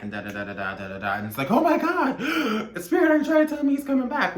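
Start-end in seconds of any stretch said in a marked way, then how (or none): 1.5-4.4 high, loud gasping excitement; hammed-up breathless hopeful outburst; overexcited querent. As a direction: squeal it fast, as if getting a sign. Acting out an overexcited tarot client.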